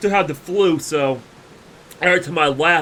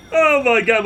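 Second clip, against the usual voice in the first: ordinary talk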